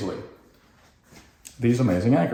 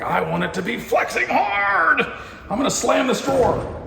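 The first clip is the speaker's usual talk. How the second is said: mock anger; 13 semitones higher than usual. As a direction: pretend rage at a drawer. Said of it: "not relaxed your hatred muscle".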